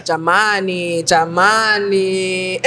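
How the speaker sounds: slow, drawn-out Luhya accent imitation; lightly voiced Luhya accent, slow and low. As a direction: stretch it out like a Kakamega Luhya. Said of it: "Luya straight out of Kakamega".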